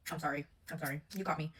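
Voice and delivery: low, soft imagined confession; mock sheepish apology. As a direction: imitate someone owning up meekly. Imagined confession when caught.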